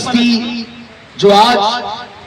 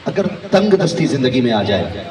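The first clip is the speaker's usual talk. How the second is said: usual speaking voice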